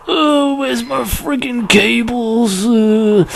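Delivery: put-on high, slow, strained old man voice; cranky old geezer complaining slowly. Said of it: "my old man impression".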